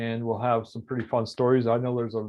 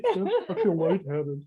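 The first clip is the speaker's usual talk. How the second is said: laughing; 7 semitones higher, much slower than usual. Real, not acted. Giddy in the hat, laughing.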